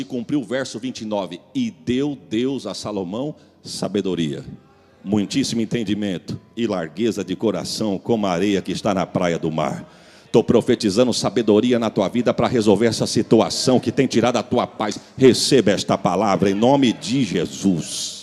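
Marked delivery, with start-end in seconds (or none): 1.5-9.9 lowered voice, reverent verse; solemn scripture reading. Acted. 10.3-18.2 loud, low, full-force declaration; preacher's forceful blessing in Jesus' name; prophetic preaching. Real, not acted. Prophesying over the congregation.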